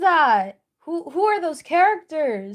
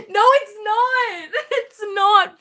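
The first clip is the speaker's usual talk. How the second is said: laughing through the words; giggly, pleading no; laughing denial; 6 semitones higher, 4 dB louder than usual. Real, not acted. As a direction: protest while cracking up. Laughing denial of chat joke.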